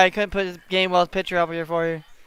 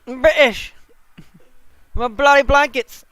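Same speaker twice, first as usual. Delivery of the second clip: hammed-up British accent, a little higher; mock British accent; 5 semitones higher, 4 dB louder than usual. Joking with friends in a mock British accent. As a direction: toss off words in a jokey British accent. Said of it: "Oh, British".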